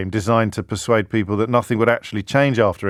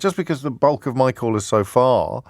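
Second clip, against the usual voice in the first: ordinary delivery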